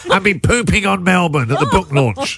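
mock sickly sick-day call voice; whining like you're pulling a sickie; fake sick voice. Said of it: "This is the voice you do when you call your boss".

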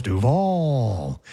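Duval with a long, sliding VAL; imitating botched cadence. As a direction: draw out the end of Duval in mockery. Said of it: "the same exact cadence as Liam Cohen's".

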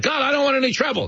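high, fast, mock-timid plea; nervous tourist. Tourists begging the hustler to leave them alone, high fast plea. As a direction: rush it out like a scared tourist. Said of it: "And they're like".

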